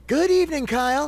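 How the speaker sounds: very high, glamorous woman's greeting; Rachel voice. A narrator's reading. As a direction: greet him in a high, charming voice. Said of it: "she said".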